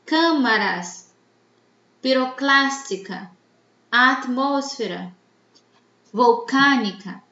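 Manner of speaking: "câmaras, piroclástica, atmosfera, volcânica", each punched on the third-last syllable; stress on antepenultimate syllable. Shown as a demonstration. Teacher gives examples of "esdrújulas", stressing CÂ, CLÁS, MOS, CÂ in câmaras, piroclástica, atmosfera, volcânica. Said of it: "la sílaba tónica es la antepenúltima".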